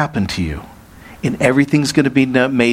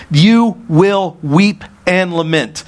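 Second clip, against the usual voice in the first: Jesus warns his disciples, slow and solemn; slow solemn warning; 5 semitones higher, much slower than usual. Acted. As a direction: lean on every word like a grave prophecy. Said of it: "Jesus looks at him and he says".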